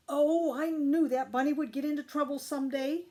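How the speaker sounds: a mother wailing in mild distress; weeping. A narrator's reading. Mama lamenting Ralph's capture, lightly weepy. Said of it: "wept Mama".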